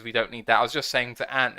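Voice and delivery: ordinary talk, nothing marked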